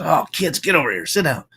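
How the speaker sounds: a retold dad half-yelling at his kids; mock yell at kids. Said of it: "Kind of yells at his kids".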